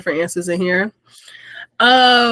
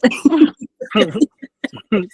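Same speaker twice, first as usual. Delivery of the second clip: short laugh; 4 semitones higher, 5 dB louder than usual. Real, not acted. Laughs at mouth-full reply.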